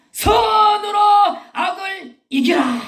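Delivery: high, loud scripture proclamation; preaching shout. Real, not acted. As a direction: cry it out to the congregation, stretching the words. Preacher proclaiming scripture at full force. (힘차게 외치는 설교)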